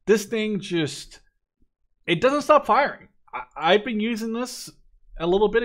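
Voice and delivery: gushing over a favorite weapon; building to a loud, high burst of enthusiasm; excited. Real, not acted.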